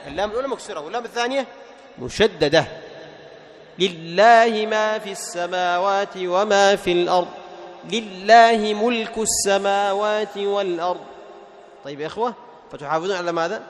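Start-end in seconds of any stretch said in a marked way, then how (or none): 3.8-11.0 slow, drawn-out recitation, "لله" with thin lam and full i; full kasra on lam. Shown as a demonstration. Teacher models verses for students, slow recitation with thin, fully voweled lam in "لله". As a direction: recite slowly, completing the i in "لله". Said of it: "على استفهام اللام وإتمام كسرها".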